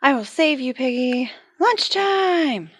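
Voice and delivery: high, loud rally; a bold heroic call; heroic cry. Acted. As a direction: charge to the rescue. Heroic rush to rescue.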